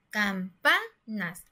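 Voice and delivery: "campanas" slowly split up, middle PA lifted; teacher's slow "campanas" with strong stress on PA; stress on penultimate syllable. Shown as a demonstration. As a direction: hit the PA in "campanas", pausing between syllables. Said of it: "esa palabra se escucha adecuadamente".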